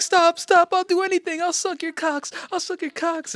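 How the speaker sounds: high-pitched, panicked pleading impression; mock freaking out. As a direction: imitate a woman begging frantically. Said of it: "freaking out".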